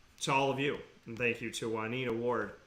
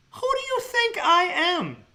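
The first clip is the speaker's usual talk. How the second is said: much higher, slow, loud challenge; mock-indignant God; 6 dB louder, slower than usual. God playfully challenges those who doubt him. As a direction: demand it with playful divine swagger.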